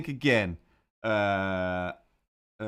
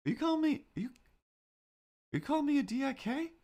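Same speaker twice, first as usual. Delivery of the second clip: mock offended. Mock offense at chat name.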